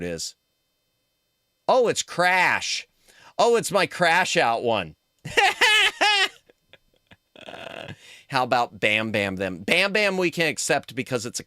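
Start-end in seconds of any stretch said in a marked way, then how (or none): 5.2-6.3 high squealing laugh. Real, not acted. Seeing his own Crash Out emote, squealing laugh. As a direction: burst of much higher, louder laughter.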